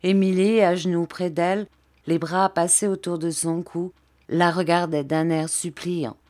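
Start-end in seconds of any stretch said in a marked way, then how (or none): none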